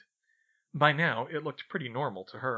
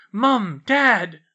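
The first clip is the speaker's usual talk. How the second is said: terrified shout for his parents, much louder and higher; yelling; 9 semitones higher, 13 dB louder than usual. A narrator's reading. Dudley fleeing from the visitor at the door, terrified yell. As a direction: scream for Mum and Dad in panic.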